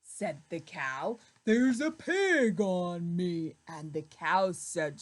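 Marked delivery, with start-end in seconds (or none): 1.4-3.6 louder cow voice. Acted. Cow complains of pig.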